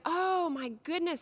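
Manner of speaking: high, slow amazed exclamation; mock surprise. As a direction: sound delighted by a discovery. Imagined discovery exclamation.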